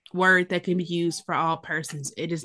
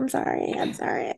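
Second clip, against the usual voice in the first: sheepish murmur; 9 semitones lower, 7 dB quieter than usual. Retold or imitated. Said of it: "And I'm like".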